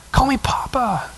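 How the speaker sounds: soft, intimate whisper; whispered plea. Acted. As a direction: whisper it like a father coaxing his child. Acts tender plea to his child.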